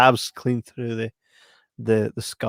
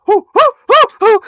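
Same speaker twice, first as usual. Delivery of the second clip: high loud hoo-hoo-hoo from burning pasta; yelping hoos, mouth burnt by pasta; hot-mouth hooing; 12 dB louder than usual. Retold or imitated. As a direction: hoo like your mouth is scalded. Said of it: "you're like".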